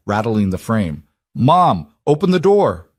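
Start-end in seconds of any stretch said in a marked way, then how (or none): 1.4-2.9 loud, higher call through a door; shouting through the door. Acted. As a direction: yell to be let in. Daughter pounding, shouting outside.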